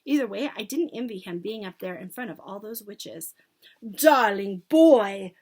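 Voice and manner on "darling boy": slow, drawn-out gushing call; syrupy sweet witch. A narrator's reading. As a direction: coo it loudly with false sweetness. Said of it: "soft and gentle and absolutely dripping with syrup".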